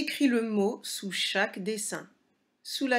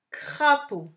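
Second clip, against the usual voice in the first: Crapaud said high, loud and slowly articulated; clear dictation voice; 7 semitones higher, 7 dB louder than usual. Shown as a demonstration. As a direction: call out Crapaud crisply for a child. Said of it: "Je vais nommer les dessins". (prononcé haut et fort, bien articulé pour la dictée)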